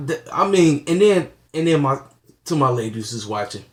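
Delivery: normal talking voice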